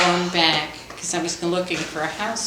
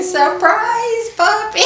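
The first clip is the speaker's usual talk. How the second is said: put-on much higher, louder little-girl squeal; imitating a tiny granddaughter shouting her surprise; high, loud toddler voice yelling surprise; 6 dB louder, slower than usual. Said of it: "my little baby granddaughter is saying".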